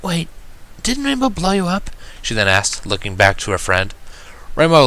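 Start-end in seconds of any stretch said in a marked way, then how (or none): 0.0-1.9 high, soft startled question; gentle high pony voice, suddenly concerned; Fluttershy voice. A narrator's reading. Fluttershy asks, surprised.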